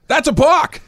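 high, loud burst of protest; re-enacted yell. Parent calling out the pitcher's move, re-enacted yell. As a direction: yell it as an instant reaction. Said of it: "your reaction is to yell".

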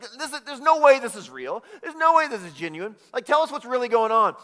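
loud, high, indignant disbelief; angry Pharisees. Retold or imitated. Pharisees scoffing at the healing, angrily. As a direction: bark it out like outraged officials. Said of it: "They're so angry".

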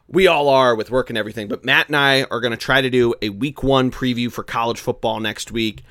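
ordinary talk, nothing marked

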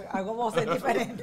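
usual speaking voice

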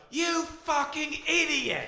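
high, drawn-out mock yell; on-camera outburst. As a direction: stretch out a theatrical insult, no real volume. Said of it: "as soon as the camera turns on".

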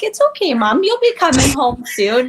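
put-on high, childlike reassuring voice; high child's voice soothing her mom; daughters comforting. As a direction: voice your little girl telling mom it's okay.

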